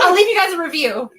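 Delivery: lightly voiced excited friend; gushing sister. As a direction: keep the thrilled friend voice going. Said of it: "Does she really talk like that?".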